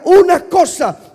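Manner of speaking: loud slow call for the echo; call and response. Shown as a demonstration. Pastor calling the church to repeat, loud. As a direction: drive it home so they shout it back. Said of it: "Repita conmigo y diga".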